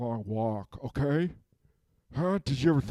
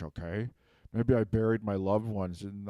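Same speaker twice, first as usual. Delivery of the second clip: normal talking voice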